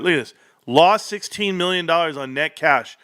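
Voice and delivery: usual speaking voice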